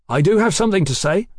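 defiant announcement. A narrator's reading. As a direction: stand up and claim the floor. Mallory stands to challenge her.

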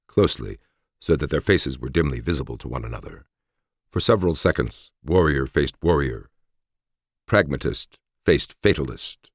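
ordinary delivery

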